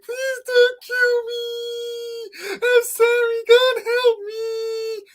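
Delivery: very high, slow squeaky mock pleading; Donnie begging. Mocking Donnie begging while being choked, very high slow whimpering voice. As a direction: whine for mercy in a tiny high voice. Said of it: "That's how he sounded".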